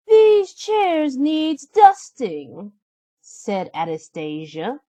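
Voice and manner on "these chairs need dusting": high, slow whiny stepsister; a spoiled stepsister piling on chores; high and drawn-out bratty sister. Acted. Stepsister voiced.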